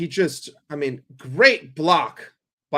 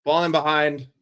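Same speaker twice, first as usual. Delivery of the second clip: usual speaking voice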